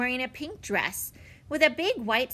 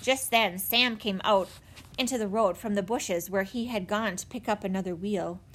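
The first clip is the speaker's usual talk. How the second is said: usual speaking voice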